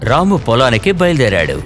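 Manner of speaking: ordinary delivery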